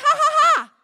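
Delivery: loud, high "ha, ha, ha"; mock laugh. Shown as a demonstration. Preacher showing how to laugh at the devil, loud mock laugh. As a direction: belt out a defiant fake laugh. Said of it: "Just say".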